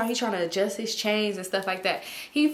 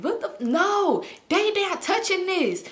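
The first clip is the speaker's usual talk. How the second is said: higher-pitched indignant imitation; mock offended; 8 semitones higher than usual. Man protective of his drug, high offended imitation. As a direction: play his affronted refusal, pitched up. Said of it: "He getting so mad".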